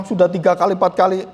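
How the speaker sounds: normal talking voice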